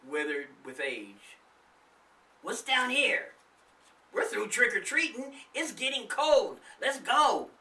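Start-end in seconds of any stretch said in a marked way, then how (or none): 2.4-7.5 put-on lightly raised kid's voice, eager to leave; a child who wants to go home; a hint of a boy's voice. A narrator's reading. Charlie to his friends, a lightly voiced boy. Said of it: "Charlie said".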